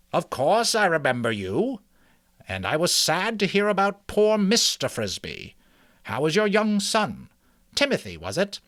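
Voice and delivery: put-on a wise old mouse greeting a visitor. Mister Ages voiced, kindly.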